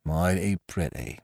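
understated pirate grumble; sceptical mutineer. A narrator's reading. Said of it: "said George".

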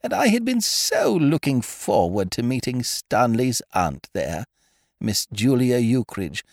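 lightly voiced lady, mildly regretful; a hint of the dowager, sighing over missed dinner; regretful old lady. A narrator's reading. Old lady regrets missing the novelist, lightly voiced and rueful. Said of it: "said Lady Lakenheath regretfully".

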